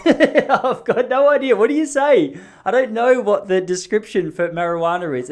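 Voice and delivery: cracking up at not knowing the words; laughing. Real, not acted. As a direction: laugh through the admission. Laughing at his own answer.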